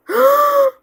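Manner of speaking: surprised high gasp; sharp high gasp. Real, not acted. Sudden gasp at notifications.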